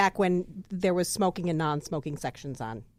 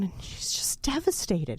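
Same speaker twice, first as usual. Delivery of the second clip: ordinary talk, nothing marked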